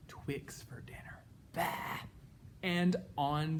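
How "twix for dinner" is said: hushed whisper. Acted. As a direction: whisper it sadly. Kids story, unhappy pea.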